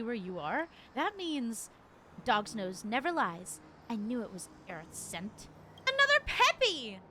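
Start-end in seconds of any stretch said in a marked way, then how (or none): 5.9-7.0 high, loud yapping cry; dog character yell. Acted. Ghost dog shouting at its double. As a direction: bark it out like an angry pup.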